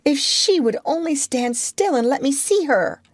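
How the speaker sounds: high, eager wish; excited cry. A narrator's reading. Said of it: "she cried".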